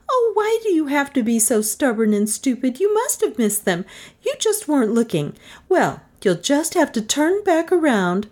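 high-pitched, exasperated scolding; scolding Melissa. Acted. Passenger berating stubborn driver, high exasperation.

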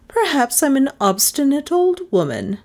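proud of her obstinacy; proud old woman. A narrator's reading. Miss Cornelia answers the others, proud and stubborn. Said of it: "in tones which obviously showed".

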